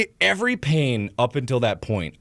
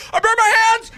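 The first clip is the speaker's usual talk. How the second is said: high, loud panicked cry; panicked yell; 6 dB louder than usual. Retold or imitated. Running past brother with burnt hands, high panicked yell. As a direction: yell it as you race past. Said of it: "like, Doppler effect and everything".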